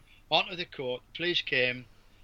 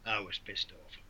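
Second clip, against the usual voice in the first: low, soft inner grumble under the breath; the angry voice inside his head, kept down; 7 semitones lower, 11 dB quieter than usual. Retold or imitated. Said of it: "this is my internal voice".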